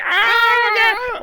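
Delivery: high-pitched acted scream; hammed-up scream of terror; mock scream. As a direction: shriek like a doomed movie character. The old lady's scream, acted.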